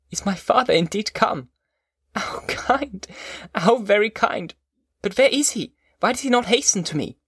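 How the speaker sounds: high-pitched, eager delight; overjoyed. A narrator's reading. Son hearing his father has come, overjoyed and eager. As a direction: marvel joyfully, pitched well up. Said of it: "relaxed from anguish to pleasure".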